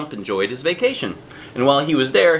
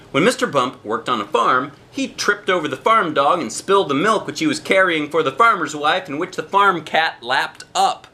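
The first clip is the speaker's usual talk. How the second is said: usual speaking voice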